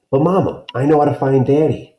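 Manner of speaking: lightly voiced pleading boy; eager child. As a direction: talk mama into it eagerly.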